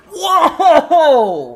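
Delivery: high, loud laughing whoa; surprised whoa. Real, not acted. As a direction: gasp out in amazement. Huge lift seen live.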